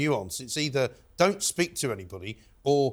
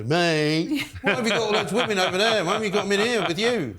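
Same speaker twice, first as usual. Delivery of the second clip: challenging mate; 5 semitones higher, 3 dB louder than usual. Acted. Acting out confronting mosque-goers with the slogan, mock indignant challenge. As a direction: act out calling someone out, pitched up. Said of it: "walk in with it and go".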